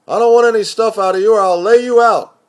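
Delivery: high, loud and menacing; loud mock threat over the phone; threatening shout. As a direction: yell the threat.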